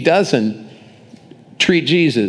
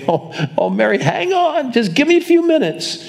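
much higher, light imitating voice, breezy; hypothetical casual Jesus; 11 semitones higher than usual. Preacher imitating Jesus putting off weeping Mary, high and breezy. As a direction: brush her off lightly, pitched way up.

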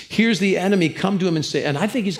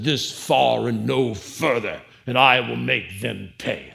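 Picard's vow; 4 semitones lower, slower than usual. Retold or imitated. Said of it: "Captain Jean-Luc Picard said".

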